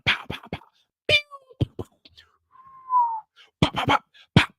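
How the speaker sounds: unvoiced popping gunfire; gunshot noises. Henry and Brian shootout, unvoiced pow gunfire. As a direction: spit out gunshots.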